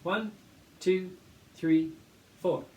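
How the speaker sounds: teacher counting in the class. Shown as a demonstration. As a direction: count them in, building. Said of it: "let's see if we can get this one".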